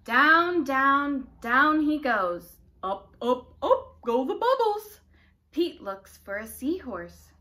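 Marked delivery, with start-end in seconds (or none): 0.0-2.6 loud, slow, drawn-out storytelling; dramatic descent. A narrator's reading. Sinking down in story. 2.8-5.0 rising voice. A narrator's reading. Bubbles rising.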